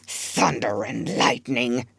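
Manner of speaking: slow, low muttered curse; muttered oath. A narrator's reading. Pirate Ben Ali curses at his fate, muttering low. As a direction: grumble the oath under your breath. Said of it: "Ben Ali muttered".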